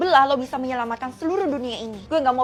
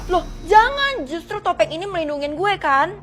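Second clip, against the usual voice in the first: alarmed protest; 3 semitones higher, 5 dB louder than usual. Acted. Masked pupil threatened by classmates, loud alarmed plea. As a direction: protest in sudden panic.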